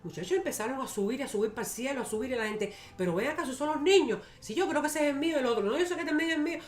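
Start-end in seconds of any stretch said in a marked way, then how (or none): 3.0-6.6 imitated agitated parents. Acts out parents watching children float away.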